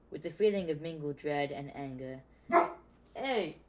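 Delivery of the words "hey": usual speaking voice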